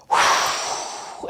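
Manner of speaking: a long dragon breath; fire breath blow. Shown as a demonstration. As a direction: blow like you're putting out a fire. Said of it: "as if you're trying to blow out fire".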